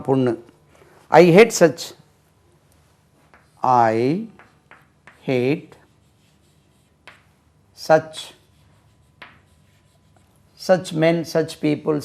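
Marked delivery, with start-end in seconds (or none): none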